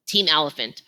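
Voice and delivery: ordinary delivery, nothing marked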